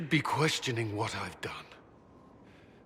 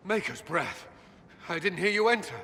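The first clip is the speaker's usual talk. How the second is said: startled gasp of words, pitched up; 7 semitones higher than usual. Acted. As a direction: caught off guard, voice jumps higher. Startled when someone walks in.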